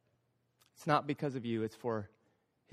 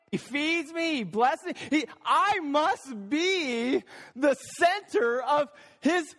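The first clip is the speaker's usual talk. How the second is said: self-centred churchgoer; 5 semitones higher than usual. Acted. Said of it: "so much like that cat-like theology".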